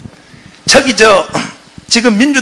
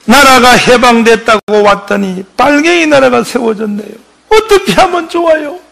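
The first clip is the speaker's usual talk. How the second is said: retold tearful prayer; 5 semitones higher than usual. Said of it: "이승만이가 울면서".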